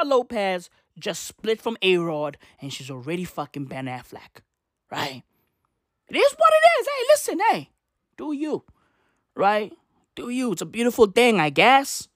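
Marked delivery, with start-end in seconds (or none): 6.1-7.4 high mocking resignation; mock falsetto. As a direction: squeak it out like a shrug.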